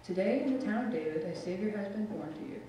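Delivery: usual speaking voice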